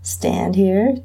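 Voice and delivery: ordinary delivery, nothing marked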